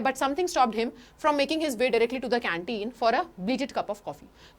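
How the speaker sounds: slow, careful reading of the English passage; slow text reading. Shown as a demonstration. Teacher reading the book passage to students, slow reading. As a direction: read each word out for the class.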